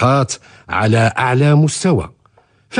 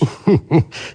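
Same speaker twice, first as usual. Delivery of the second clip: short laugh; 5 semitones higher than usual. Real, not acted. Father laughs at son.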